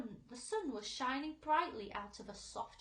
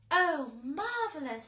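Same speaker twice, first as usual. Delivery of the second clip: high, loud centipede delight; delighted exclaim; 7 semitones higher, 12 dB louder, slower than usual. A narrator's reading. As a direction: gush over the peach. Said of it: "said the centipede".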